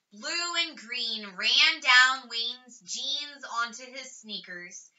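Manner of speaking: ordinary delivery, nothing marked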